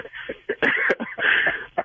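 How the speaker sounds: high airy laugh; breathy laugh. Real, not acted. Pranked husband to radio host, airy disbelieving laugh.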